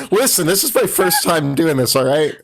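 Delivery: giggling through the words; laughing while talking. Real, not acted. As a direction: laugh through an embarrassed excuse. Laughing defence of weak speech.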